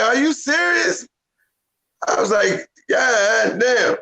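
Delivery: mock-stunned God damn; high pitched astonished exclamation; retold disbelief. His amazed reaction retold.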